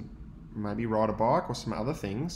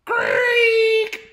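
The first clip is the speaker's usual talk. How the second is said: high, loud shouted squeal of a creaking wheel; 13 dB louder than usual. A narrator's reading. Teacher voicing the tricycle's screech, loud high squeal. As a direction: shriek the creak out sharply.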